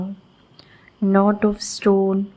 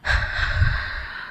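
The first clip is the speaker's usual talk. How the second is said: slow exhaled sigh of release; long soft sigh; 5 dB quieter than usual. Acted.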